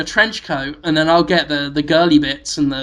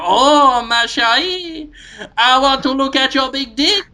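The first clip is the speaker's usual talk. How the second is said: camp French seductress, pitched way up; mock French lover; 7 semitones higher than usual. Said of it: "And I go".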